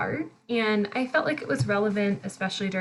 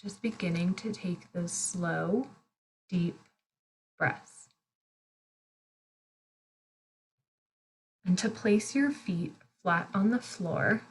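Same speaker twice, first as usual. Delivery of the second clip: guided meditation. Real, not acted. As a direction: guide them gently through each breath, unhurried. Leads a breathing meditation.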